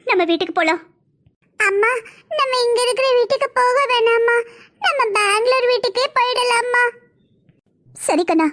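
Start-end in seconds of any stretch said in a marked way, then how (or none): 1.6-4.4 put-on high tearful little kid begging; crying child. Small son begs mother not to stay, tearful high child voice. 4.8-6.8 put-on high pleading little kid's voice; child voice. Small son begs to go back to Bangalore, high child voice.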